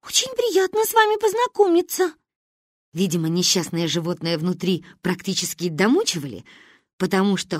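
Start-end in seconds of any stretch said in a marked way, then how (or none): 0.0-2.1 very high-pitched rehearsed politeness; nervous child. A narrator's reading. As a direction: force out a polite childlike greeting. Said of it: "мобилизовав остатки сил".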